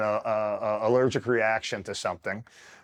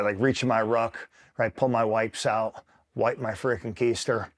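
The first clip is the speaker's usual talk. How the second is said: ordinary talk, nothing marked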